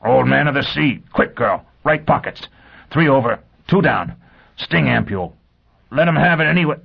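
high, strangled urgent orders; throttled voice in short bursts. A narrator's reading. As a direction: force out instructions while being choked. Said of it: "he said in a strangled voice".

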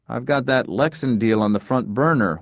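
plain reading voice

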